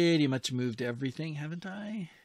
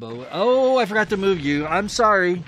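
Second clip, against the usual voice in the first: higher and louder, a rushed oh oh sorry; flustered apology; 5 semitones higher, 9 dB louder than usual. Real, not acted. Player apologizing to a forgotten submarine, flustered and loud. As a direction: caught out, apologizing to your own sub.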